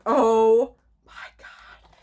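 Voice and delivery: slow awed whisper; breathless whisper. Real, not acted. Stunned fan whispering to viewers. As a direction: breathe it out in awe.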